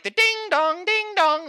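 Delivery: mock bells. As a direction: chime like loud bells. Imitating bells.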